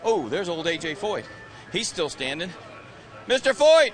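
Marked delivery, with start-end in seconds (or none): 3.3-3.9 loud, high hail; calling out. Real, not acted. Reporter hails A J Foyt on the grid, calling out. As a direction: shout the name to get his attention. Hailing an old driver on grid.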